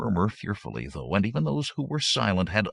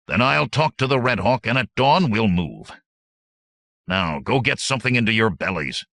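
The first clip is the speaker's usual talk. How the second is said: loud, raised leader's voice; giving orders; 5 semitones higher, 7 dB louder than usual. A narrator's reading. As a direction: settle it over the band's objections. Hordo gives orders over objections.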